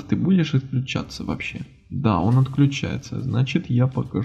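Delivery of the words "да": ordinary delivery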